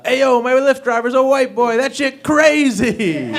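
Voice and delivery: high, loud hammed-up passenger voice; imitating passenger. Passenger bragging about his driver, in a high hammed-up voice. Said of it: "He goes".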